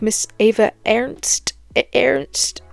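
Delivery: slow, questioning attempt at "Ernst"; unsure pronunciation. Real, not acted. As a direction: try "Ernst" haltingly, as if asking how it is said. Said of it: "How, how you say?".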